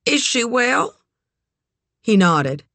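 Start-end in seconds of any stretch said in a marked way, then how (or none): 0.0-1.0 high, lilting question; high-pitched, courteous concern; Eleanor's polite inquiry. A narrator's reading. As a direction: ask after someone in a lifted, sociable voice. Eleanor asks after Vera.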